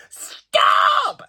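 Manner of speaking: high, loud mock yell of stop; retold shout. Tourists yelling at her on the staircase, high mock shout. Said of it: "they just started shouting".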